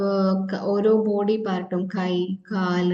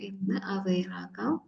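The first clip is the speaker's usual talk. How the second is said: whisper; 10 dB quieter than usual. Acted. Guiding awareness in meditation.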